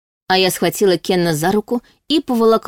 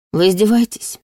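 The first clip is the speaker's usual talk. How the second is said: breathed out in anger; 3 semitones higher than usual. A narrator's reading. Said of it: "выдохнула я ему в лицо".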